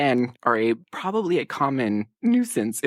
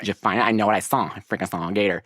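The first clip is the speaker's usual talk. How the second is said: mock Karen; 7 semitones lower, faster than usual. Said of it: "Missus Biggins is full Karen".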